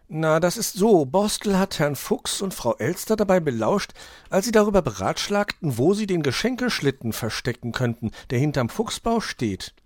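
put-on high, loud young detective voice; Hoppel explaining. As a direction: explain the case to a friend, pitched well up.

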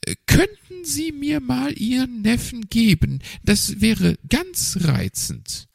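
high, nervous magpie voice. A narrator's reading. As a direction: play the magpie up high and hesitant. Magpie asks politely.

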